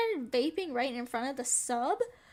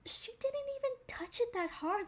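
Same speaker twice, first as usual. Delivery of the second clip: much higher, a lightly voiced child defending her; kids' voice; 10 semitones higher than usual. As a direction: pitch it up like a classmate protesting. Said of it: "they're like".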